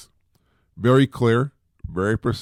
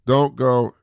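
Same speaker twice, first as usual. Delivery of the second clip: ordinary talk, nothing marked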